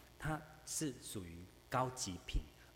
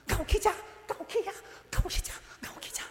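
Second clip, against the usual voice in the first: much higher, louder, fast and frantic; a panicked mother crying out, rushing the words; 9 dB louder, much faster than usual. Retold or imitated. Preacher imitates a frantic mother calling her lost child home. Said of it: "那个妈妈就说".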